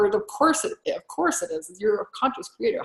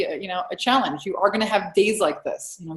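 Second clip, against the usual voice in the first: ordinary talk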